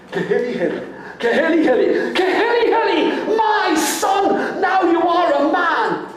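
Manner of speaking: a mother's cry building to joy; joyful cry. Acted. Mother recognising her son, rising to a joyful cry.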